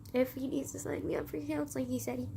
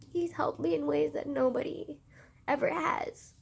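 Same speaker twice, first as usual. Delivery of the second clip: real tearful voice, higher and halting; crying; 4 semitones higher than usual. Real, not acted. Vlogger in tears over how uncle has helped, crying. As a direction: choke up and struggle through with long pauses. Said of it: "Sorry for crying".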